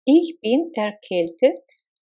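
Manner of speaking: usual speaking voice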